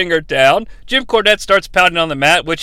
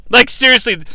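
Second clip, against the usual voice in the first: ordinary delivery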